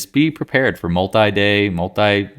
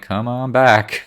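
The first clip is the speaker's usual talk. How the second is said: slow coaxing; 4 semitones higher, 3 dB louder, much slower than usual. Retold or imitated. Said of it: "I'm like".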